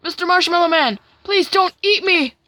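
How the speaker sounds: high, loud mock plea; hammed-up cry for mercy; pained pleading. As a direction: beg for your life in a high cartoon voice. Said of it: "falls onto the ground in pain".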